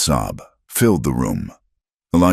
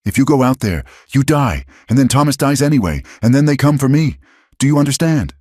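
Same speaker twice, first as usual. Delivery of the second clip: much higher, louder, fast; fierce plea; 10 semitones higher, 4 dB louder, faster than usual. Acted. Eliza pinning Cal to the wall, fierce loud plea. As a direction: force the truth into him.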